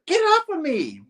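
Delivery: put-on high, loud girl struggling. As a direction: push him off in a squeal. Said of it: "she's like".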